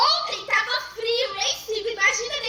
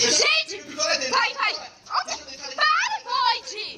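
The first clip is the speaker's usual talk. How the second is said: high alarmed shouting; panicked shouting; 8 semitones higher than usual. Real, not acted. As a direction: call out in frantic panic. Girlfriend panics he froze.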